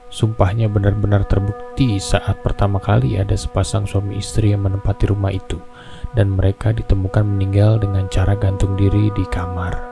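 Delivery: ordinary delivery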